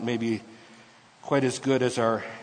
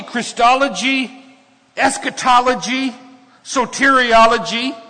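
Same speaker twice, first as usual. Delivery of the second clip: full-force preaching, higher and louder; 8 semitones higher, 7 dB louder than usual. Acted. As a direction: build it up like a revival sermon.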